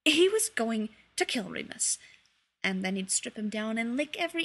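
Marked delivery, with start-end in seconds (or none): none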